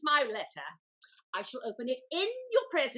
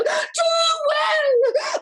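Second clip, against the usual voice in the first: much higher, loud crying shout; sobbing shout; 12 semitones higher, 12 dB louder than usual. Acted. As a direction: wail it out at the peak. Lady lamenting the betrayal.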